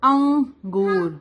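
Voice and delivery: انگور slow with the nasal ng; nasal ang sound. Shown as a demonstration. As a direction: model انگور drawing out the ng. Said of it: "جیسے ہم انگور بولتے ہیں". (انگور کی ناک والی آواز)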